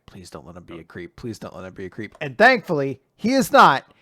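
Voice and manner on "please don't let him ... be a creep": much softer, lower, almost under the breath; quiet worried plea to herself; hushed plea. Retold or imitated. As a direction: murmur it like a nervous private prayer. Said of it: "there was a moment I was like".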